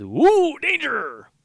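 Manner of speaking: high, loud woo danger cry; mock alarm. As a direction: squeal it like a cartoon alarm.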